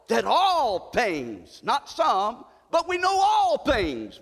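all things drawn out slow and shouted high; stress on all. Acted. Preacher insisting to the church, slow and shouting. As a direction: hammer the word all, slow then shouting.

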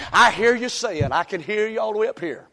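usual speaking voice

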